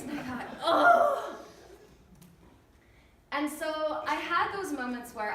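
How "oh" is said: high, loud exclaimed oh; exasperated "oh". Retold or imitated. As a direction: cry out "oh" in mock dismay. Mother reacting to mimicking kids.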